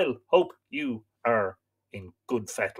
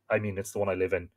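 usual speaking voice

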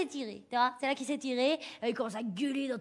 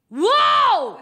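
high, loud whoop; victory whoop; triumphant shout; 11 semitones higher, 15 dB louder than usual. Acted. Punchline shout after her retort.

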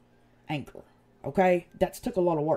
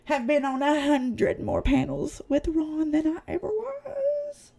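ordinary talk

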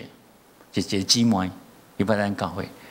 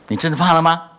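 higher, louder challenge; challenging son; 6 semitones higher, 7 dB louder than usual. Retold or imitated. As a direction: push the question up and loud.

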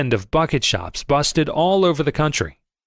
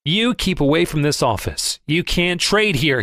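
plain reading voice

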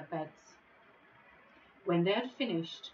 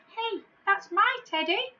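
put-on high, loud indignant child; boy's cry; 13 semitones higher, 7 dB louder than usual. Tom shouts at the hand stealing his teddy, high and indignant.

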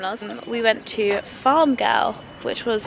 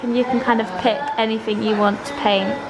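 normal talking voice

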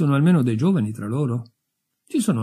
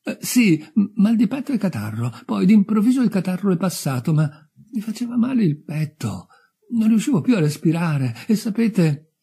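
higher, hesitant adolescent voice; anxious youth; 8 semitones higher than usual. Acted. Newly dead youth describes his chest trouble, anxious and high. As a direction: stumble eagerly through the symptoms.

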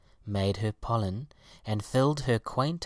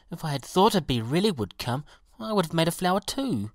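higher-pitched, protesting pansy; rueful pansy; 7 semitones higher, 3 dB louder, faster than usual. A narrator's reading. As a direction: make an excuse in a lightly voiced, raised pitch. Pansy's regretful last word.

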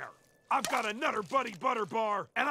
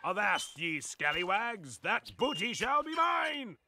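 pirate voice. Acted. Dressed as a pirate.

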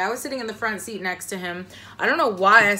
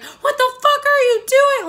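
shrieked high and loud at the cops; a furious, very high drunken yell; retold yell; 9 dB louder than usual. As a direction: scream it at the officers. Yelling at police arresting girlfriend.

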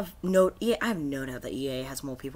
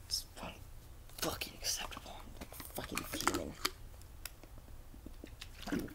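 hissed, much softer and lower; seething whisper; 10 semitones lower, 15 dB quieter than usual. Real, not acted. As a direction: mutter it with bottled rage. Seething at broken game.

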